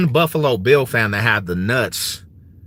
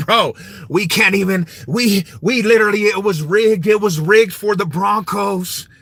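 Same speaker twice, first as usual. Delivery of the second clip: a touch higher, whining it was rigged; mock whining fans; 5 semitones higher than usual. Mocking Bills fans, lightly whining complaint. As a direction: complain like a sore loser, lightly voiced. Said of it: "They were like".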